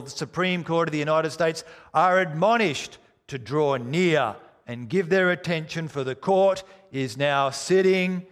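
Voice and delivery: American court marshal calling the court to order; mock American marshal's cry, oyez; American accent. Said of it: "with an American accent".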